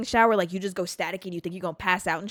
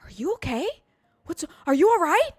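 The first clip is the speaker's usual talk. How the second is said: high-pitched alarmed questions; freaking out; 9 semitones higher, 3 dB louder than usual. Retold or imitated. Friends running at the crashed kid, freaking out. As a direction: mimic panicked friends, pitch swooping up. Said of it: "Freaking out".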